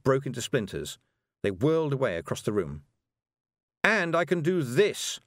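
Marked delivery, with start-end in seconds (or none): 3.8-5.2 slow, louder triumphant shout; a defiant young witch crowing. A narrator's reading. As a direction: show off, proud and loud. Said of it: "Tiffany shouted".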